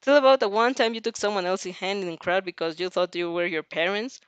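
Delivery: ordinary delivery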